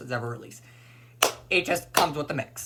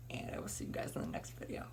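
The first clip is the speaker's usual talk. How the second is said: whispered sign-off; breathy whispered goodbye; 16 dB quieter than usual. Real, not acted. Signing off the video.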